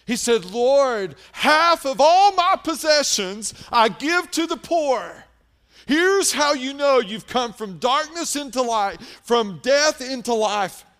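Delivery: loud, high, driving sermon voice; fiery revival preaching; preaching at full force. Acted. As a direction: preach it out to the whole church, pressing hard.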